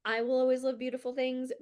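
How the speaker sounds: ordinary talk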